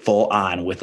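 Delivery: ordinary talk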